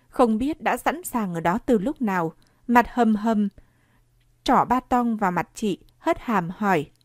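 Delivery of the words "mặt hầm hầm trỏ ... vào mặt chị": ordinary delivery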